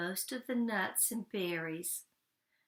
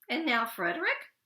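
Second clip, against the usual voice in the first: mice asking; 4 semitones higher, 5 dB louder than usual. Acted. Mice question Frederick.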